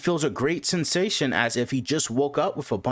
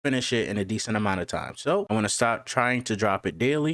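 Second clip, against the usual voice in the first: plain reading voice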